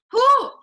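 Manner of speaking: loud, high breath-out to shake off tears; emotional exhale. Real, not acted. Team leader pulling herself together after nearly crying, high loud whew. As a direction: blow out hard to steady yourself. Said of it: "I'm not gonna cry".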